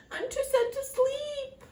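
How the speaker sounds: high, plaintive storybook whine; mock sadness. A narrator's reading. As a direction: whimper it high like a sad little dwarf.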